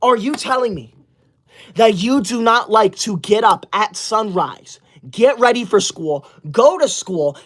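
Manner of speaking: ordinary delivery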